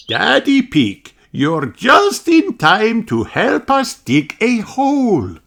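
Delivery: high, slow, kindly teacher voice; a teacher explaining to her class slowly; Madame Gazelle voice. Acted. Teacher telling children about the time capsule, high and slow.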